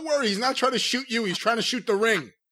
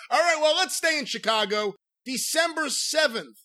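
ordinary talk, nothing marked

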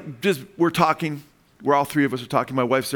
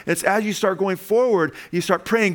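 fervent preaching; 5 semitones higher than usual. Acted. Pastor rousing the congregation, fervent preaching. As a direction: preach it up with growing fire. Preaching build about praying.

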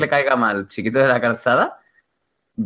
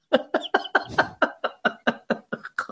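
wheezing laugh; 11 semitones higher, 7 dB quieter than usual. Real, not acted. Group cracking up.

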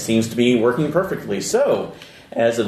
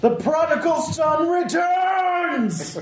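very high, slow, laughing villain cry; theatrical villain shout; much slower than usual. Acted. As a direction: proclaim it like a mad villain.

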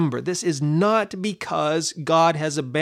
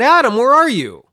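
loud call, higher, like searching a garden; 8 semitones higher, 7 dB louder than usual. Acted. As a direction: call out the name across the garden. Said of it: "God calls out".

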